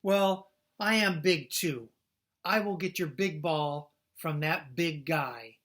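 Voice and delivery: loud, slow heroic boast; bold boast. Acted. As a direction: puff up and promise it. Gerald boasting bravely.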